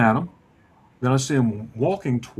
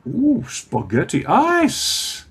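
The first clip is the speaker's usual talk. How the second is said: high, slow, drawn-out wonder; a discovery moment, stretched out; amazed gasp; 9 semitones higher, 4 dB louder, much slower than usual. Retold or imitated. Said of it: "he's like".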